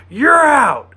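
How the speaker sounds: high, loud shout of an umpire calling an out; umpire's call. Retold or imitated. Odo practicing alone in his quarters, shouting an umpire's out call. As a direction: bellow it like a ballpark umpire.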